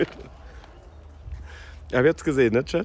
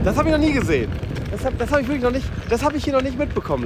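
amazed; 9 semitones higher, 6 dB louder, faster than usual. Real, not acted. Astonished at a sight on stream.

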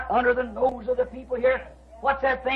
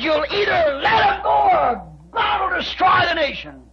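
rising, shouted sermon climb; fiery preaching; 4 semitones higher than usual. Acted. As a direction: build each how could higher until shouting. Preacher builds to full force.

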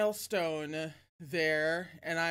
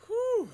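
shaky exhale; 14 semitones higher, 6 dB louder than usual. Real, not acted. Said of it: "All right".

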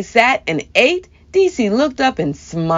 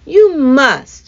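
insisting; 5 semitones higher, 5 dB louder than usual. A narrator's reading. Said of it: "insisted Meemaw".

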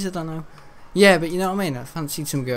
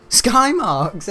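louder, higher, laughing it out; 8 semitones higher, 7 dB louder than usual. Real, not acted. Amused by his own horse name, laughing. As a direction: crack up on the name.

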